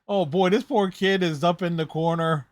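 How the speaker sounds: ordinary delivery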